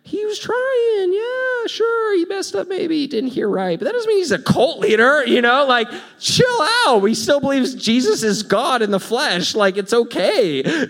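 mock-soothing voice of heaven; heaven's voice. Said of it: "heaven's like".

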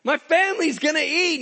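high, loud shouts of joy; hammed-up jubilant yelling; exuberant. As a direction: whoop it out like a worker paid a windfall. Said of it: "they're just exuberant".

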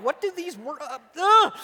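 high babble breaking into a loud grunt; flustered struggle. Retold or imitated. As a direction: act straining at a heavy reel, ending in a grunt. Acts out struggling with the reel.

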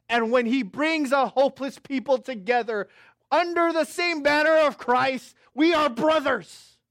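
loud, high pulpit preaching, slowing on the last words; preaching at full force. Acted. Pastor preaching to his congregation about being brothers, rising preaching. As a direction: lift the room to the word brothers.

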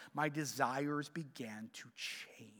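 slow whispered point; slow down and whisper the key line; hushed, slow emphasis. Real, not acted.

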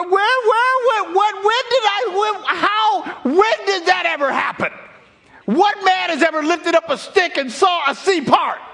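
high, loud, laughing disbelief; incredulous outburst. Retold or imitated. Preacher imagines protesting God's command to part the sea: loud laughing disbelief. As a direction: shout in comic disbelief. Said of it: "in which I would have said".

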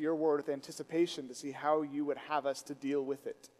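low, soft, measured praying voice; prayer. Real, not acted. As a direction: lead the congregation quietly in prayer. Leads the opening prayer.

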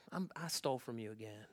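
low, soft, halting admission; acted shamefaced mumble; sheepish confession. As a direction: own up quietly and reluctantly. Acted thief confessing third time.

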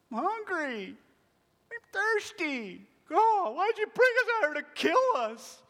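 high, slow, rising whine; whiny child. Retold or imitated. Israelites as whiny kids complaining to God, high whine. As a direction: whimper like a hungry kid. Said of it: "how our kids act".

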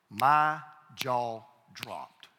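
drawn-out, spaced words; dramatic emphasis. Real, not acted. Preacher repeats his astonishment to congregation, dramatic emphasis. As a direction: stretch "My jaw dropped" out very slowly.

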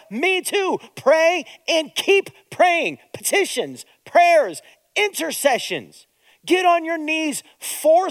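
rally the congregation to keep praying; fiery sermon climax; preaching at full force. Real, not acted.

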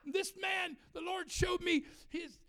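high-pitched mock prophet's proclamation; hammed-up prophetic declaration; prophesying. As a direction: proclaim it like a revelation. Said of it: "I start prophesying".

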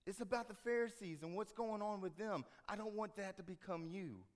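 ordinary talk

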